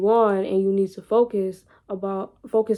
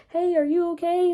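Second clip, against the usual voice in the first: high, light voice of a worried passerby; a stranger calling out in concern; lightly voiced passerby checking on her; 8 semitones higher than usual. Imagined passerby asking her.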